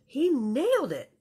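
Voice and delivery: ordinary delivery, nothing marked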